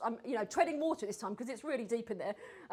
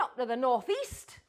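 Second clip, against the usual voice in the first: ordinary talk, nothing marked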